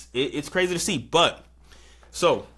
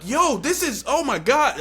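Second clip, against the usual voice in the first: high, worried exclamation; alarmed; 11 semitones higher than usual. Retold or imitated. Narrator fearing for the fighter, high alarm. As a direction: panic as she falls. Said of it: "I'm like".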